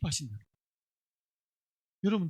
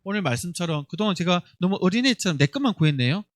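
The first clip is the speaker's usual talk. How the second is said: put-on a lightly raised prayer voice for the children to follow; leading prayer; 3 semitones lower than usual. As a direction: pray aloud as the example. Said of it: "우리 같이 고백합니다".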